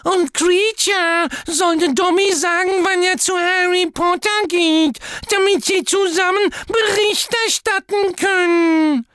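Dobby eagerly reporting to Harry; squeaky house-elf. A narrator's reading. Dobby voice continues.

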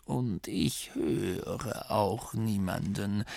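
put-on slow, soft, dismissive old voice; calm old abbot. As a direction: say it calmly, barely bothering. Abbot continues denial.

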